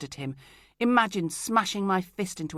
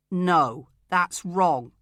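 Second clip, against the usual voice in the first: much louder blunt no; loud flat denial; 9 dB louder, much slower than usual. Acted. Craig contradicting her in the corridor, much louder. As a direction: bark it at her.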